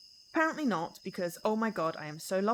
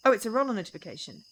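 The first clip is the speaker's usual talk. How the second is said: ordinary delivery, nothing marked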